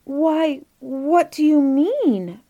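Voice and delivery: high-pitched, drawn-out astonished question; puzzled cry. A narrator's reading. Children questioning Inge about his presents, puzzled cry. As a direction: cry out in bewilderment. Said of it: "cried the children".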